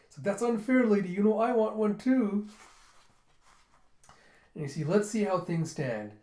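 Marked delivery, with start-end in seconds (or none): 0.2-2.5 high, pleading complaint; envious whine. Retold or imitated. Character to lady commissioning a drawing, envious whine. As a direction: whine for one of your own.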